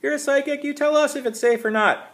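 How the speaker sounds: put-on high, loud taunting kid's yell. A narrator's reading. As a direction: jeer it from up the tree. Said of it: "one of the kids yelled".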